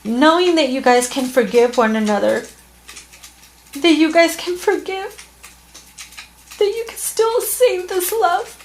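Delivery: high, tearful voice on the edge of breaking; fighting back tears while reassuring them; choked up. Real, not acted. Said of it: "Sorry, I got a little emotional".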